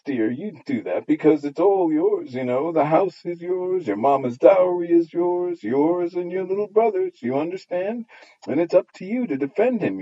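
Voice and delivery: high, sugary busybody voice; fawning neighbour. Acted. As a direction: wheedle the little girl into taking sides. Neighbour women coaxing Nene.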